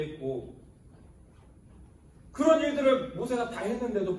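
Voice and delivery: high, loud, building sermon; preacher's rising fervour, loud and high; preaching at full force. Acted. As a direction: pour it out to the congregation.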